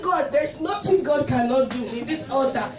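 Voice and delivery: loud, high, laughing testimony shout; joyful church shout, laughter breaking through; ecstatic shouted praise. Real, not acted.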